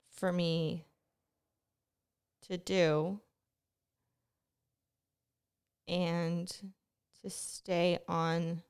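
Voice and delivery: very slow, with long pauses; word by word, reining in your pace; deliberately slowed down. Real, not acted. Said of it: "I really do need to slow down".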